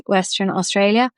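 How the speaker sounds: ordinary talk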